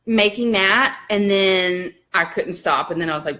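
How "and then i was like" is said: normal talking voice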